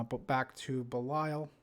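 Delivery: ordinary talk, nothing marked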